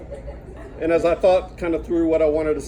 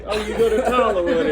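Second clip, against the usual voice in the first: bright, higher quoted voice; imitating eager question; 6 semitones higher, 4 dB louder than usual. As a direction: play the eager asker.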